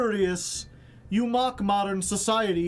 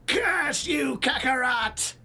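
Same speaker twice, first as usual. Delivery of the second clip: high furious villain shout; Frieza; 5 semitones higher, slower than usual. Retold or imitated. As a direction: spit it like an outraged space tyrant. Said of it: "Frieza's like".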